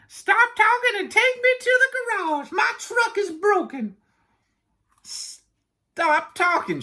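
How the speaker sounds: very high put-on character voice; Camel voice. Yak imitating Camel ordering him to the garage, high-pitched.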